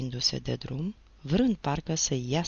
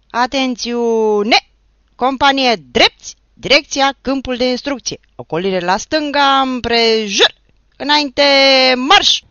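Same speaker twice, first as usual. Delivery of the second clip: much higher, loud, drawn-out orders; drill commands; 8 semitones higher, 13 dB louder than usual. Acted. The teacher orders the march, shouting commands. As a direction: stretch and bark each command.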